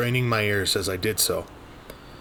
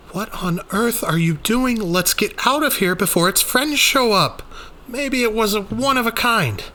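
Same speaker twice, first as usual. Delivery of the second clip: much higher, louder, urgent; alarmed Rainey; 13 semitones higher, 5 dB louder than usual. Acted. Rainey urging Daniel to leave, alarmed. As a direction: hurry him away in alarm.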